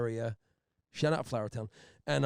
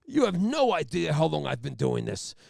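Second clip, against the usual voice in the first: Jack Nicholson impression; 3 semitones higher, 5 dB louder than usual. Trooper lectures the courtroom like Jack Nicholson. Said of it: "like he's Jack Nicholson".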